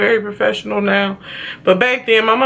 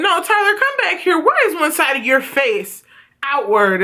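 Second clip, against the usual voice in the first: put-on high, sharp babysitter calling her back; babysitter yelling; 7 semitones higher than usual. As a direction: squeal it like the alarmed babysitter. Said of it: "she went".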